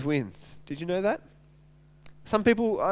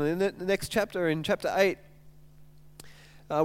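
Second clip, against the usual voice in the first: ordinary talk, nothing marked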